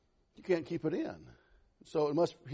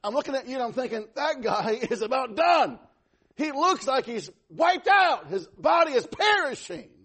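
loud, high preaching voice; forceful preaching; 8 semitones higher, 7 dB louder than usual. Real, not acted. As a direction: stretch key words with loud emphasis.

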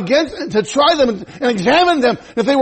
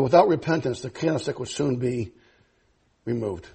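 low, soft voice of God; voice of the Lord; 10 semitones lower, 8 dB quieter than usual. Acted. Preacher voicing the Lord rebuking the church, low and soft. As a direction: speak as the Lord, lower and softer. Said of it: "He said".

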